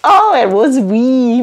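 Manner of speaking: normal talking voice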